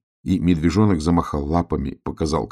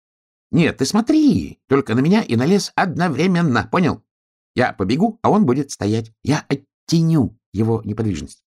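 high, excitable hedgehog voice; impatient little creature, pitched high and louder; insistent hedgehog; 9 semitones higher, 5 dB louder than usual. Acted.

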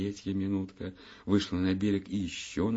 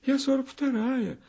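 high, small voice of a little minute; a wee girl answering the hour; Minutka's tiny voice; 3 dB louder than usual. Acted. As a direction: speak as a tiny child-like minute. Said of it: "ответила Минутка".

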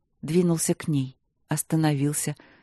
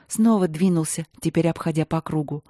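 plain reading voice

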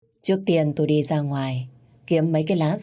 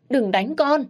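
a high, loud tearful cry; crying plea; 10 semitones higher, 4 dB louder, slower than usual. Acted. Mother begging the father to stop, high tearful cry. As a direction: beg him to stop hitting her child. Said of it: "Má tôi cứ khóc và van xin".

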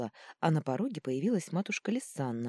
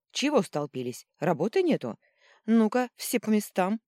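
loud scolding; 6 semitones higher, 7 dB louder than usual. Acted. Healer scolds crowding staff.

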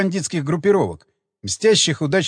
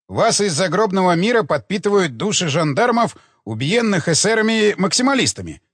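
ordinary delivery, nothing marked

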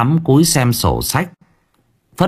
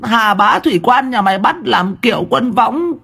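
cursing wife; 6 semitones higher than usual. Acted. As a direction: rail at the unseen chicken thief. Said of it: "tiếng của vợ ông cứ thế mà choa choa".

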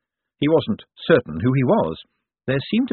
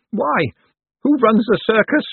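bewildered outcry; 12 semitones higher, 5 dB louder, slower than usual. A narrator's reading. Bent's bewildered outburst.

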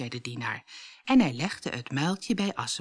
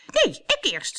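high-pitched squabbling shout; 10 semitones higher than usual. A narrator's reading. Truida fighting her sister for the slipper, shouting. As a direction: shout to be first. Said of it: "schreeuwde Truida".

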